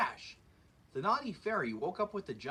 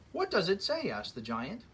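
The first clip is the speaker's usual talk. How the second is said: lively read-aloud storybook voice; story narration; 5 dB louder than usual. A narrator's reading. Reading a fairy tale to children, lively storyteller voice. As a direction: read it like story time for kids.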